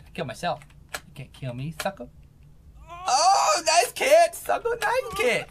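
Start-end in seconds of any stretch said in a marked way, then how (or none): 3.1-5.4 squeaky taunt. Acted. Voicing game character taunt.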